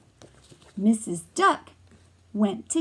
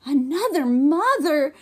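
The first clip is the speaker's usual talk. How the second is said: higher, louder shocked cry; shocked mother mouse; cried out; 5 semitones higher, 6 dB louder than usual. Acted. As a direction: cry it out in alarm. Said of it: "cried Missus Mouse".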